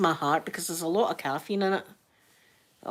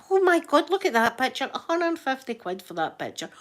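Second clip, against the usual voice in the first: appalled at price; 6 semitones higher than usual. Retold or imitated. Retells outrage at shop prices.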